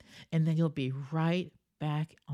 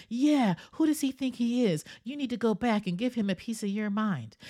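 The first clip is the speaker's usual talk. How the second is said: higher, louder mock outrage egging a friend on; 7 semitones higher, 5 dB louder than usual. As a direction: stoke the fire like an indignant buddy. That person's like.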